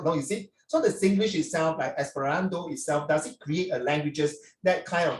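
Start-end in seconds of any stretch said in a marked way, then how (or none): none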